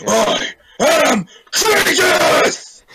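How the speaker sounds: huge, high, drawn-out roar; slow, full-throated heroic shout; battle shout. Acted. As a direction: bellow your own name as a war cry. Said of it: "expecting you to shout".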